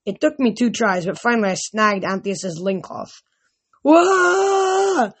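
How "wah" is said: high, loud yelp of a giant caught by surprise; startled giant yelp. A narrator's reading. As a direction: yelp up high, yanked off his feet. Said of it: "he yelled".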